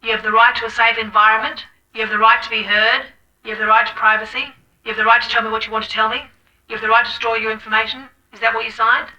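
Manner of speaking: brisk counsellor. Acted. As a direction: rush through the rights like a form. Counsellor reads patient's rights.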